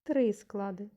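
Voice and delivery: ordinary talk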